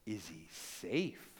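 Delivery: low, hushed and slow, a little afraid; timid question. A narrator's reading. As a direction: ask it softly, almost afraid of the answer. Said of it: "she was a little bit afraid".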